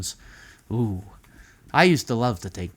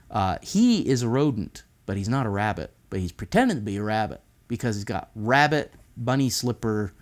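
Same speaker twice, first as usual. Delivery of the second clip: plain reading voice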